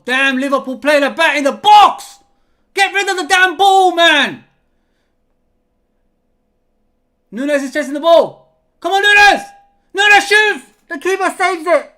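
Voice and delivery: loud, high-pitched yells at the play; urgent football watchalong yelling; excited match shouting. Real, not acted. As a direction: shout the chance as it happens. Live match commentary, chance unfolding.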